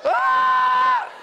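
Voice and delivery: loud, very high imitated baby scream; long piercing mock wail; newborn's scream. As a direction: scream like a newborn in full cry. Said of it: "And she goes".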